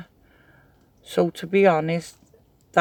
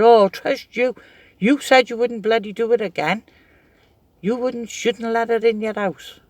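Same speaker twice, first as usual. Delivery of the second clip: higher, louder telling-off; mock scolding, higher and louder; scolding sister; 5 semitones higher, 4 dB louder than usual. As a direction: tell her off like an exasperated sister. Sister scolding her again.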